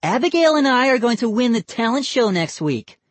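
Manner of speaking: put-on high, boastful girl's call; calling after. A narrator's reading. Girl tells cousins she will win, calling after them. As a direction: boast it to those leaving. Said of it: "Molly called after them".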